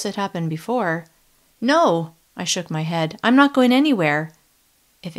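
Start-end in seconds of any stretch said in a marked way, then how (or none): 1.6-2.1 loud, high refusal; a loud, high defiant no. Acted. 3.2-4.3 loud, high refusal; a loud, high defiant no. Acted.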